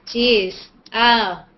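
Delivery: ordinary delivery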